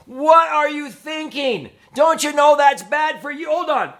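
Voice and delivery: high, loud angry parent yell; a parent losing it at sons caught smoking, yelling high and loud. Retold or imitated. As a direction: blow up at the kids, shouting high. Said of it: "lose it and go".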